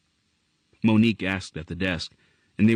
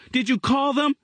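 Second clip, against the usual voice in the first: put-on high, urgent boy's demand; a mild near-yell at a little sister; nearly screamed. A narrator's reading. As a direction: snap the question at his sister. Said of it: "he nearly screamed at her".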